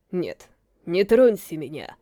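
low, slow, feeble dying king; barely able to speak, fading; weak voice. A narrator's reading. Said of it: "слабым голосом возразил Родрик".

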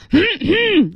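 forceful, deliberate throat clear; loud throat-clearing. Shown as a demonstration. Teacher imitating the girl's noisy bid for attention, exaggerated throat-clearing. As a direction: clear the throat with big effort to be heard. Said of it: "So she was like".

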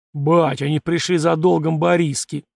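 usual speaking voice

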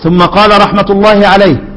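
ordinary talk, nothing marked

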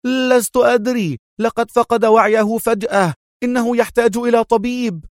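high-pitched show of alarm; feigned panic. A narrator's reading. Adham fooling club members, feigned panic. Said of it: "أجابهم أدهم متظاهرا بالفزع".

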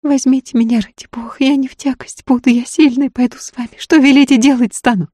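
high, imploring woman's voice; anxious pleading. A narrator's reading. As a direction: beg desperately to be taken along. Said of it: "Тревожные глаза умоляюще смотрели".

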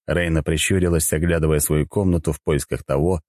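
plain reading voice

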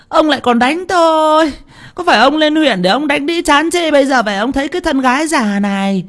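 high, keening complaint; wailing wife. A narrator's reading. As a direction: wail and lament in a high voice. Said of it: "mà khóc giống lên".

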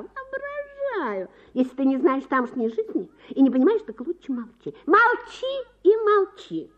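wife mocking her husband's jealousy. A narrator's reading. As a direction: scoff at his jealousy.

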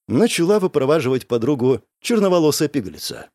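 plain reading voice

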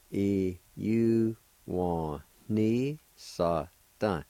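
"E U wa ni sa da" drawn out very slowly; slow joined-up word. Shown as a demonstration. Teacher modelling the whole word very slowly. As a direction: stretch "E U wa ni sa da" slowly as one word. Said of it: "It's pronounced like this".

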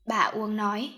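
usual speaking voice

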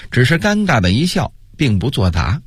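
ordinary delivery, nothing marked

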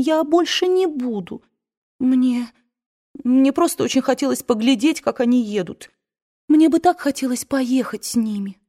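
lightly voiced girl begging her aunt not to cry; lightly voiced Scarlett. A narrator's reading. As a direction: coax the old aunt, a touch whiny. Narrator voices young Scarlett pleading.

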